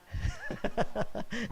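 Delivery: real amused chuckle; light laugh. Real, not acted. Preacher laughs after asking about neckties, light chuckle.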